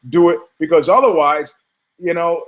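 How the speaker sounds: usual speaking voice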